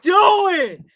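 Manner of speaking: slow, loud, stretched-out shout; exasperated shout. Real, not acted. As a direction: demand an answer from the player. Ranting at the quarterback.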